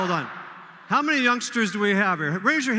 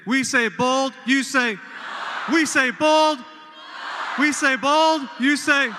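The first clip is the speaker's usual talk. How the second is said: higher and louder, shouting the chant; cheer call; 6 semitones higher, 6 dB louder, slower than usual. Shown as a demonstration. Host to the crowd, shouted call-and-response chant. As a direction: lead the crowd chant at full voice. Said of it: "bold, you scream north".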